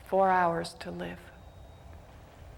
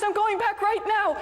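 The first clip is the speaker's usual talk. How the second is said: much higher, excited, merry declaration; eager resolve; 13 semitones higher, 4 dB louder than usual. Acted. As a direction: announce it like nothing can stop you. Drunk Moshe decides.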